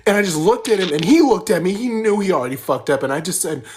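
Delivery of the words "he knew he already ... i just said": ordinary delivery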